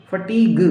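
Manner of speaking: फटीग said fa-teeg with no u; silent u. Shown as a demonstration. Teacher showing फटीग, no u or e. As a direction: say फटीग without any u sound. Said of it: "यू हम नहीं पढ़ते".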